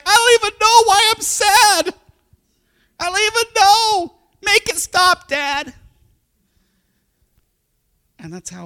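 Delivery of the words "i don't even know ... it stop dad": high, loud mock kid wail; crying little son. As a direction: imitate a distraught small boy pleading with his dad. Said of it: "And he's like".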